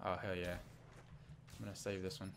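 normal talking voice

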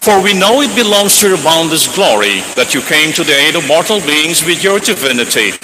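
normal talking voice

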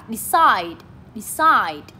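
decide with CIDE strong; stress on second syllable. Shown as a demonstration. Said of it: "Cũng là lên giọng ở âm số hai luôn".

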